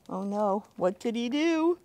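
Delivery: usual speaking voice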